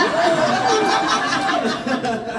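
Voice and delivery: shrieking high laugh; high cackling laugh. Real, not acted. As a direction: burst into a helpless cackle. Recalling the absurd moment.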